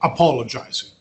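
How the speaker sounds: ordinary talk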